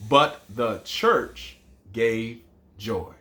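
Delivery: even, slow repeat; slow recitation. Shown as a demonstration. Teacher repeating the last line slowly for counting. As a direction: say it whole, slow and steady. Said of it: "our first line was five".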